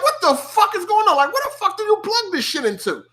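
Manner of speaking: high, confused what-the-hell rant; baffled outburst. Retold or imitated. Struggling with an ethernet cord, confused and exasperated. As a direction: act out being totally lost with the cord. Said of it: "I'm like".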